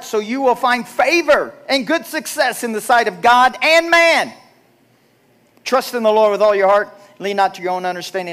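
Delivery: scripture recital rising to a loud peak; preaching scripture. Acted. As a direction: proclaim it to the congregation.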